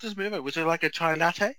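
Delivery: hammed-up meek driver offering a chai latte; mock overpolite. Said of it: "stumble through the swearing".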